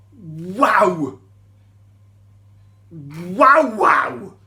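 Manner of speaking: loud, drawn-out wow of amazement; awestruck wow. Real, not acted. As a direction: gasp it out in awe. Awe at the blazing angel.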